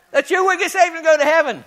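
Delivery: fired-up preaching. Acted. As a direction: stoke the crowd with it. Repeats it after asking who's excited.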